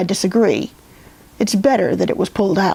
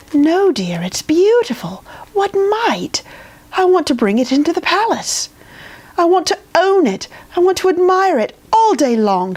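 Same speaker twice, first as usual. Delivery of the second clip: high, breathy-whispered start, rising queen voice; a doting queen who must own it; adoring queen; 8 semitones higher than usual. Acted. Queen adores asparagus.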